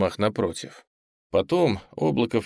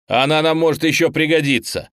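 raised, high and loud reproach; indignant; 7 semitones higher, 6 dB louder than usual. A narrator's reading. Teacher scolding a student over the peroxide, indignant. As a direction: protest sharply at the waste. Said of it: "возмутился Юрий Эдуардович".

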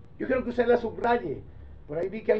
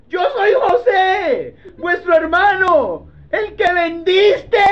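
much higher, louder, slow tearful shout; crying shout; 9 semitones higher, 8 dB louder, slower than usual. Acted. Joseph crying out to the brothers who sold him. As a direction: shout through tears at the brothers.

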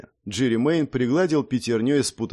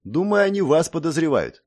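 ordinary delivery, nothing marked

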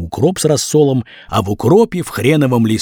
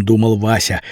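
plain reading voice

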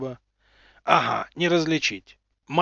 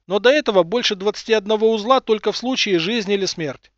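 higher, louder engineer, explaining matter-of-factly; chief engineer voice; 5 semitones higher, 5 dB louder than usual. Acted. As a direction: play the chief engineer briefing the admiral. Главмех объясняет в трубу.

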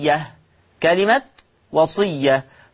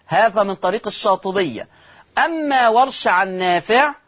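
ordinary talk, nothing marked

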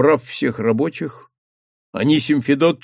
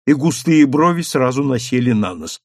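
usual speaking voice

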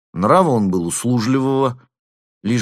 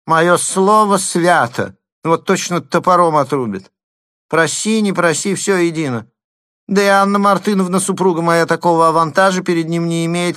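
high, louder whining lisp; lisping complaint; 10 semitones higher, 4 dB louder than usual. A narrator's reading. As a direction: grumble hotly with a lisp. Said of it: "своим шепелявым голосом".

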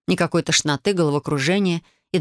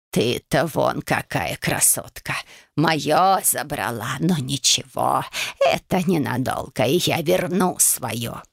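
low, slow croaking hag; old witch voice; 4 semitones lower, slower than usual. A narrator's reading. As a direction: croak it like a greedy crone.

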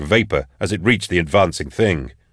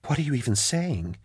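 soft, higher-pitched disbelief; incredulous gasp; 6 semitones higher, 9 dB quieter than usual. A narrator's reading. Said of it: "she gasped at him, incredulous".

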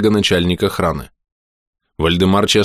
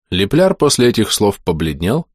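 ordinary delivery, nothing marked

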